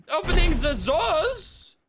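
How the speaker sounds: put-on high, slow German-accented exclamation. Player announcing a move in the game, hammed-up German accent. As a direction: call it out in a mock German accent. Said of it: "he has embraced the German accent".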